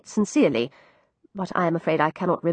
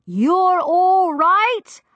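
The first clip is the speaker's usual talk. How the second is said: loud, high, slow shout; bawled into her ear; 10 semitones higher, 4 dB louder, much slower than usual. Acted. Girl shouting into the deaf landlady's ear. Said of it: "bawled directly into her ear".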